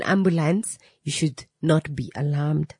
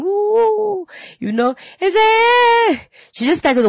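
high wailing mock sob, much higher; imitating crying wail; 5 dB louder, slower than usual. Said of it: "she started crying".

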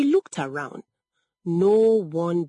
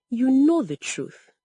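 usual speaking voice

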